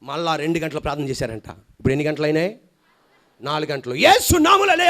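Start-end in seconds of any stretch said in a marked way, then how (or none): 3.9-4.9 high, loud command to rise; a ringing preacher's command; commanding shout. Retold or imitated. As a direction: call the dead up with authority.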